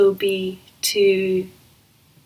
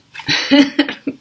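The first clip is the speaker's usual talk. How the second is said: sudden laugh; 8 semitones higher, 6 dB louder than usual. Real, not acted. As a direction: burst of high laughter. Laughs at own stumbling.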